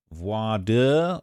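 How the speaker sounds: voir dire as a slow, questioning "vwah-duh?"; trying out pronunciation. Real, not acted. Speaker checking how to pronounce it, voir dire as a tentative "vwah-duh?". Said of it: "pronounce voir dire".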